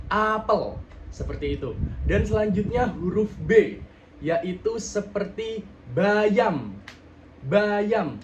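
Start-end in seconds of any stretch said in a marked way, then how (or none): 0.1-0.7 "apel" with a clearly opened A; apel jelas. Shown as a demonstration. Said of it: "harus jelas". 5.9-8.2 "bayam" slowly, with a full open syllable; bayam jelas. Shown as a demonstration. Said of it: "artikulasi pelafalannya itu jelas".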